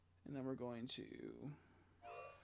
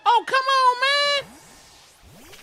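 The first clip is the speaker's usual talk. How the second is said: much higher, much louder raging yell; furious scream; 19 dB louder, much slower than usual. Real, not acted. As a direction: scream at the game in full rage. Game goes wrong.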